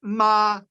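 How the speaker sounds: Ma held on one steady high pitch; first tone ma. Shown as a demonstration. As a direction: sing Ma level and high like a held note. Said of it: "one steady high pitch".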